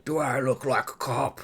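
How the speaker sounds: hammed-up dark hero voice; marbles in mouth. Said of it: "Do I have marbles in my mouth?".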